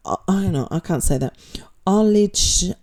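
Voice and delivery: usual speaking voice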